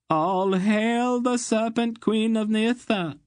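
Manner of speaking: high, trembling, slow proclamation; quavering awed announcement; shaking voice. A narrator's reading. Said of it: "pronounced in a shaking voice".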